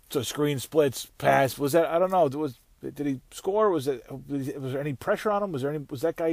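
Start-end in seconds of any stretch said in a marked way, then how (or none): none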